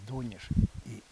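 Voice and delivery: ordinary talk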